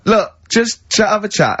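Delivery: drawn-out, gently pushing; slow coaxing. Retold or imitated. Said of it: "And I went".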